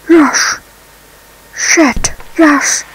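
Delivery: ordinary talk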